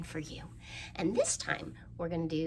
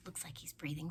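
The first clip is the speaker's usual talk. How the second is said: whispered aside; 3 semitones lower, 8 dB quieter than usual. Real, not acted. Showing the dragon craft.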